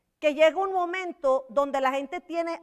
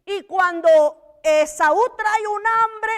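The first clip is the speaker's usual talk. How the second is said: slow loud emphasis; 5 semitones higher, 7 dB louder, slower than usual. Real, not acted. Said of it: "preacher stresses hunger".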